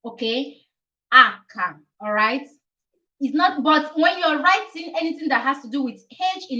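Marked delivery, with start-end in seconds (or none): 1.1-1.7 "H" said acca, the Italian letter name. Shown as a demonstration. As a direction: model "H" as "AK-ka". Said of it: "It's called H".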